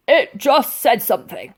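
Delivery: a suspicious soldier snapping at them; snarled. A narrator's reading. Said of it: "snarled the sergeant major".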